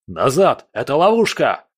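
loud, high urgent shout; warning shout. A narrator's reading. Buran warns comrades of a trap, shouting. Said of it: "крикнул Буран".

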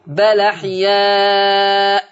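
بل أحياء very slow, bal-aḥyā' with open lam; slow Quranic chant; transferred vowel. Shown as a demonstration. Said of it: "هتبقى اللام مفتوحة".

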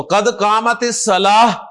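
الصلاۃ ending as-salaah, slow and deliberate; final h sound. Shown as a demonstration. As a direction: slowly close الصلاۃ on a breathed h. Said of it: "ہا کے ساتھ پروناؤنس کرے".

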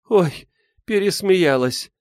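out of breath from laughing. Acted. Liza after laughing fit.

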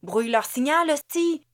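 loud command rising to a high cry; barked order. A narrator's reading. Ruby orders X to jam the signal, shouting. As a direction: order it forcefully, climbing on the curse. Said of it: "ordonna Ruby".

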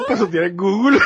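laughing shout, high; bursting out laughing. Real, not acted. Both cracking up mid-segment.

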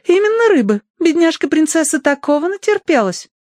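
chin up, insist on it; haughty lady. A narrator's reading. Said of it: "вздёрнула подбородок".

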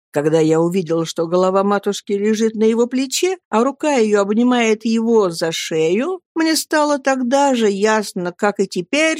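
ordinary delivery

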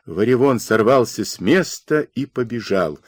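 plain reading voice

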